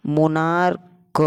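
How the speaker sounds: "मोनार्क" slowly, the final k split off; sounded-out k. Shown as a demonstration. Teacher sounding out the word, "मोनार्क" slow with detached k. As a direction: draw out "मोनार्क", then release a separate "क". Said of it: "ये मोनार्क क है".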